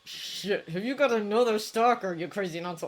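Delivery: rough, breathy, teasing; rough teasing jab at the old man; Dabi's voice. A narrator's reading. Teen teasing his guardian over text, rough and mocking.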